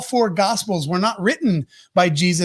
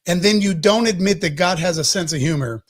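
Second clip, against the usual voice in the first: ordinary talk, nothing marked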